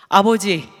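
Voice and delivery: a loud anguished call to the father; a retold cry for a father's answer. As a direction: call out to God with all your voice. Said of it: "그냥 목이 터져라고 몇 번 부를까요?".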